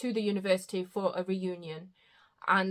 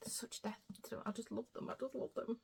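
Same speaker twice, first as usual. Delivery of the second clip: whispering; 3 semitones higher, 15 dB quieter than usual. Real, not acted. Gushing about beloved characters.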